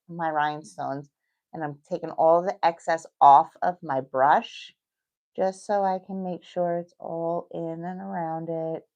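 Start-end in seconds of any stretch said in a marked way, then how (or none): none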